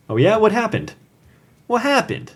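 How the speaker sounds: high, excited questions; eager curious patron. Retold or imitated. Regular at the diner asks Jackie eagerly, voice jumping high. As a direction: play the nosy regular pressing for details.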